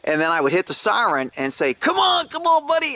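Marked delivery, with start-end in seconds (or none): 1.7-3.0 mock shout to bring the dog running; calling out. Retelling calling his dog to the car, high call.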